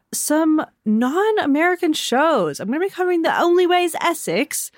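high-pitched put-on Essex accent; British accent. Podcast host teasing upcoming non-American shows, mock British accent. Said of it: "I'm sorry to my U K listeners for that accent".

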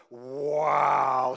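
a loud, awed wow; call-and-response wow. Shown as a demonstration. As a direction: lead the congregation in a big wow. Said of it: "just go like this. Go".

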